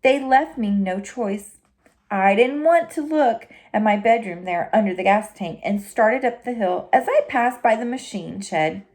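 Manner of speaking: ordinary delivery, nothing marked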